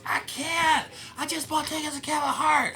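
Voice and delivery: high, bright mock girl voice; girl at a bar. Girls at a bar asking about his show, mock high voice. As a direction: squeak it like an excited fan. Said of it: "one girl goes".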